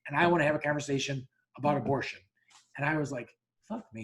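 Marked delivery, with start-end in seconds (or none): 3.7-4.0 a quiet, sunk, under-the-breath curse; muttered aside. Retold or imitated. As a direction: mutter it to yourself in dismay. Dreading an abortion debate at baseball.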